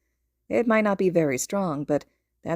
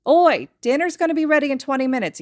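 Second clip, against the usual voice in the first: loud, higher sharp mom warning; snapping; 8 semitones higher, 6 dB louder than usual. A narrator's reading. Mother snaps at her son as he passes. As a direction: snap it at your son. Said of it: "she snaps".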